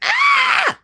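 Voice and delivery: sudden high yelp of pain; a short, high scream, imitated from memory; retold scream. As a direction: cry out sharply, as if woken by pain. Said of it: "and I just screamed".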